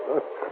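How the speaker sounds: short, soft amused laugh; soft chuckle. Acted. American amused by woman's remark, soft chuckle. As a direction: chuckle quietly at the flattery.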